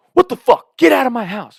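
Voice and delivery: high, loud furious shout; angry host yell. Retold or imitated. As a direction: bark him out of the house. Host furious, throwing them out.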